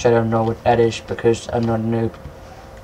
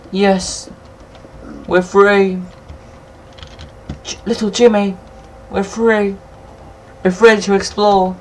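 triumphant joy; 8 semitones higher than usual. Real, not acted. Gamer rejoicing at escaping the cave, high and excited. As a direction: cheer it like a liberated hero.

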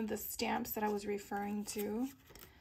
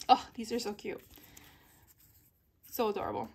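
ordinary talk

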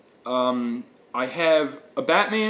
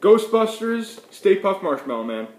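excited; 6 semitones higher, 5 dB louder than usual. Real, not acted. Delighted discovery.

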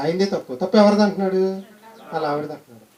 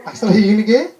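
laughing; 3 semitones higher, 8 dB louder, slower than usual. Real, not acted. Laughing at the joke's twist.

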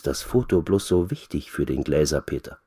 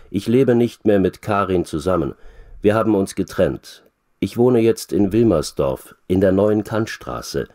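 plain reading voice

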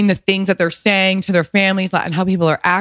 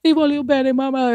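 whisper-close loving farewell, pitched up; tender Italian goodbye; 8 semitones higher than usual. Retold or imitated. To her dying mother-in-law, tender and higher.

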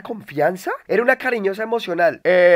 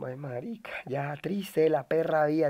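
much softer, slow self-pitying sigh of a line; mock lament; 4 semitones lower, 11 dB quieter than usual. Streamer mock-mourning his bad luck with escorts, soft and slow. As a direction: play it as a hammed-up sad sigh.

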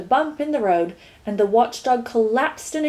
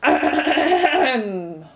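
throat-clearing ahem; 3 semitones higher than usual. Acted. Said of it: "roared the gateman".

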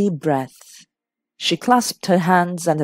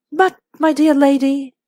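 higher-pitched, protesting gentleman; remonstrating; 9 semitones higher, 4 dB louder than usual. A narrator's reading. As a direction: object in courteous disbelief. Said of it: "remonstrated Sir Henry".

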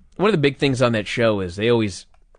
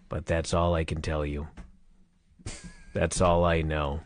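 much lower, much softer dead drone; Crockett monotone; 8 semitones lower, 9 dB quieter than usual. Retold or imitated. Cohost slips into Crockett's monotone reading the news, low and soft. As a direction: let it sink into a bored mumble. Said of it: "That was closer".

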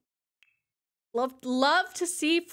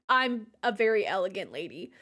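ordinary talk

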